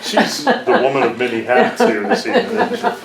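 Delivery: high, loud, laughing remark; amused, laughing. Real, not acted. Next speaker teasing the presenter to the room, laughing. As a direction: tease through real laughter.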